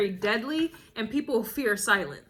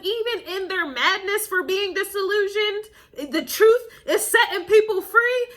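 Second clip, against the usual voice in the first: laughing, much higher and louder; half-shrieking with laughter; 8 semitones higher, 5 dB louder than usual. Real, not acted. Reader laughing as the truth sets people free. Laughing at her own point.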